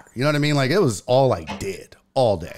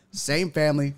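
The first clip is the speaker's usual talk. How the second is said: normal talking voice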